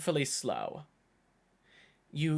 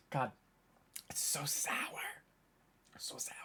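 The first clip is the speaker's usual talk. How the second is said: breathless whisper, much softer; wincing whisper after a sour shot; 9 dB quieter than usual. Real, not acted. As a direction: recoil from the taste.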